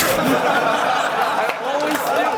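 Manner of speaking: loud, high, very slow yell; drawn-out shout. Real, not acted. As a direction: stretch the words into a full shout. Retakes it after coach urges intensity.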